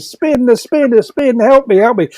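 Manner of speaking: imitating a panicked cellmate; mock panic. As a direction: mimic the terrified man on his bed. Retold frightened inmate pleading.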